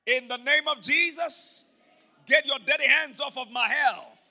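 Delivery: loud, slow rebuke of Satan; shouted declaration. Shown as a demonstration. Pastor leading the church in a shouted rebuke. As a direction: command the devil off, full voice. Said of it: "Say with me, everybody, say".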